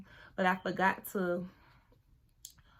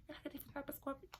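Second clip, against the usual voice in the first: quick, higher, hushed mock of her; fast mock cousin; 6 semitones higher, 15 dB quieter, much faster than usual. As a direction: rattle it off like her. Mocking the cousin's remark.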